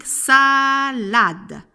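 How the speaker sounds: "SAAA-LADE", loud and slow; syllable by syllable. Shown as a demonstration. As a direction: split "salade" in two with a long first vowel. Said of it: "Il y a deux syllabes".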